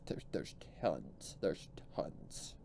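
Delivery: half-whispered, dropping away; whispered aside. Real, not acted. Cohost to partner about bad stories, whispered aside. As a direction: murmur it under your breath.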